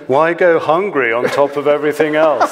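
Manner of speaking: ordinary talk, nothing marked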